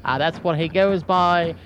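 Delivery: ordinary talk, nothing marked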